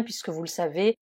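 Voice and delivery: ordinary talk, nothing marked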